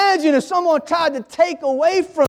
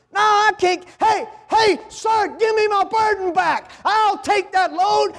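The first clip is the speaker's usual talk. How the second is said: pleading shout; 6 semitones higher than usual. Acted. Donkey begging for his burden back, higher pleading shout. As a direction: call out desperately, slowing on I'll take that load.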